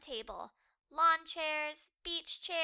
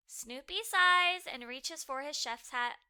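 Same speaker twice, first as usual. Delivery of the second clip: ordinary delivery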